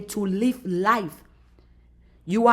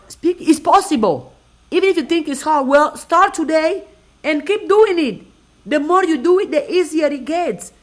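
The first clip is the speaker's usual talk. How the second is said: higher and louder encouragement; rallying; 6 semitones higher, 5 dB louder than usual. Real, not acted. Telling viewers to start today, loud upbeat urging. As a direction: lift them up, full voice. Motivational climax.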